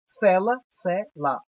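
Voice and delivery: ordinary talk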